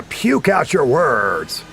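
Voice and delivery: slow, swooping hammed-up announcer; puking DJ delivery. Shown as a demonstration. Streamer explains radio slang to chat, puking announcer delivery. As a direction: belt the words out, drawn-out like a cheesy radio DJ. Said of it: "was puking".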